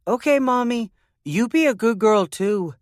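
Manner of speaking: put-on high little-girl voice echoing her mother; a small girl playing grown-up back at her mom. A narrator's reading. Little girl repeating her mother's words back to her, high.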